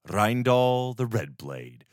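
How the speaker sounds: plain reading voice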